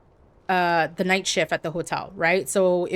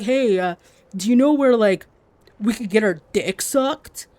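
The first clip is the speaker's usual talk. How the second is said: crude bro; 2 semitones higher, 3 dB louder than usual. Retold or imitated. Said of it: "they're all, like, giggling".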